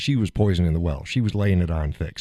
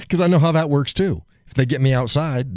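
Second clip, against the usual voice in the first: normal talking voice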